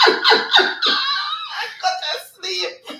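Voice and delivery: very high, loud words squeezed through laughter; slow, drawn out by a real laughing fit; helpless laughing. Real, not acted. Host cracking up at the chat, helplessly laughing.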